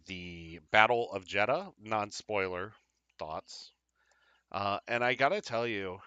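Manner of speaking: ordinary talk, nothing marked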